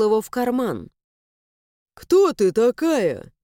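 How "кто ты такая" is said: asked through a cough; slow, coughing, rough question. Acted. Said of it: "Сквозь кашель спросил он".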